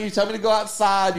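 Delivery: ordinary talk, nothing marked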